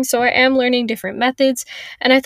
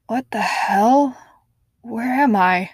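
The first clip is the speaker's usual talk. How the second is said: confused waking; 3 semitones higher, 4 dB louder, slower than usual. Acted.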